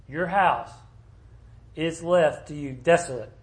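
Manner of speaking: ordinary talk, nothing marked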